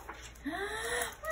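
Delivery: high, drawn-in gasp; big gasp. Acted. Narrator gasping before the last reveal. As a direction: gasp dramatically.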